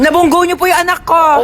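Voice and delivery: upset accusation. Acted. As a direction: confront them in alarm. Parent confronts driver over hit child.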